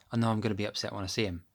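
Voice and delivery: normal talking voice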